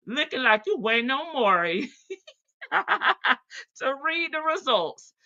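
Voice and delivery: amused giggling; laughing. Real, not acted. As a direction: chuckle while teasing. Joking about Dion, laughing.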